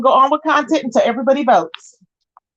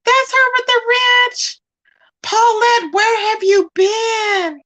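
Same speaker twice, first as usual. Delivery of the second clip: excited squeal; 10 semitones higher, slower than usual. Real, not acted. Streamer spotting a friend in chat, high excited squeal. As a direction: squeal her name with delight. Thinks Paulette arrived.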